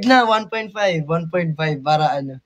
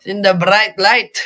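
slow mock Scottish accent; playful Scottish impression, unhurried; 2 semitones higher, slower than usual. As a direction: put on a Scottish accent, drawing out each word. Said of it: "Um, Scottish".

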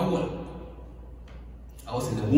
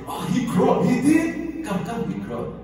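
excited mom; 9 semitones higher than usual. Retold or imitated. Mom voiced telling father.

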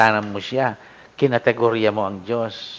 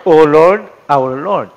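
"O Lord, our Lord" high and loud, stressed; emphatic address; 4 semitones higher, 9 dB louder, slower than usual. Shown as a demonstration. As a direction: call out "O Lord" with weight. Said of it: "kumbaga, emphatic".

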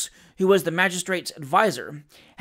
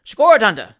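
loud, cheeky Cockney quip; loud cheeky retort; 4 semitones higher, 11 dB louder than usual. Acted. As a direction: throw it back cheekily. Sam mocking magistrate.

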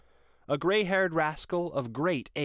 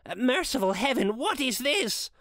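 higher, shaken pleading; horrified Scrooge; 9 semitones higher than usual. A narrator's reading. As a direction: cry out to the spirit in dawning horror. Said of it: "listened to this dialogue in horror".

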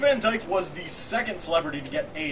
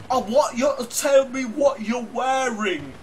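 high, loud, slow Cockney come-on; 6 semitones higher, 5 dB louder, slower than usual. Retold or imitated. Asking for phone sex, hammed-up Cockney. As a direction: lewd phone call in bad Cockney. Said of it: "He's like".

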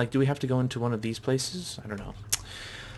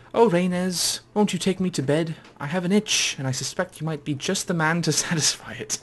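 higher put-on voice, propositioning a customer; high-voiced mock seduction; 4 semitones higher, 4 dB louder than usual. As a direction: coax playfully, pitched up.

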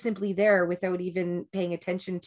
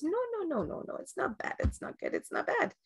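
high, soft, half-whispered mock guru; Sadhguru impression; 10 semitones higher, 4 dB quieter than usual. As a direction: play the laughing guru waving it all away. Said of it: "He goes".

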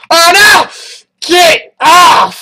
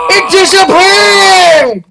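drawn-out shocked shout, a bit higher; shocked yell; 5 semitones higher, much slower than usual. Real, not acted. Player yelling at a glitch that just happened, drawn-out shocked shout. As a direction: stretch it out in disbelief.

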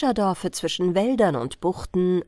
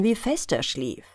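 ordinary delivery, nothing marked

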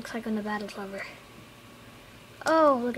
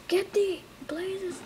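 higher, slow urging on; eager cheering; 8 semitones higher, much slower than usual. Real, not acted. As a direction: egg them on, stretched out. Cheering on mobs in battle.

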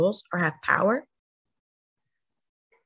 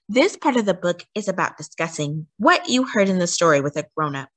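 ordinary delivery, nothing marked

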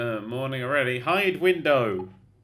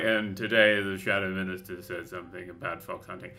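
lower, formal newsreader voice; news anchor voice; 7 semitones lower than usual. Retold or imitated. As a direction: read it like a stuffy newscaster. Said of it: "instead of just being all like".

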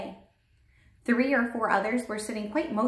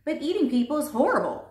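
higher-pitched little outburst of horror; appalled cry; 7 semitones higher, 4 dB louder, faster than usual. A narrator's reading. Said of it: "Sophie cried".

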